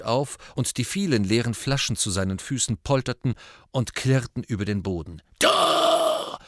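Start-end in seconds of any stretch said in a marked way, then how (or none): 5.4-6.4 hoarse bellow; drunken roar. Acted. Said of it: "brüllte er".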